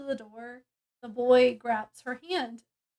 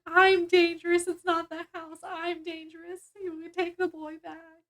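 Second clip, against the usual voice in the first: higher voice, imitating bursting into tears; mock tearful outburst; 5 semitones higher than usual. She gives up the boy after her magic erupts, tearful. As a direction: wail it high, as if in tears. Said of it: "she bursts out into tears".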